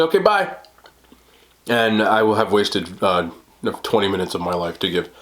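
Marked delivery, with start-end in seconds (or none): 0.0-0.5 high mock doctor farewell; imitating the doctor's quick goodbye. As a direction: toss it off like a hurried doctor. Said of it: "And then she's gonna say".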